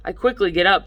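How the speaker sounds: ordinary delivery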